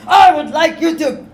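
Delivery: loud order. Acted. Angry mayor orders the sheriff.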